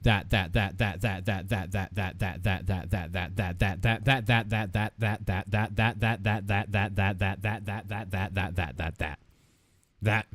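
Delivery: fast low chant of "that, that" on and on; rapid repeated "that". Shown as a demonstration. Streamer rattling "that" for chat. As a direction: rattle off the word until it loses meaning. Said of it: "Are you ready?".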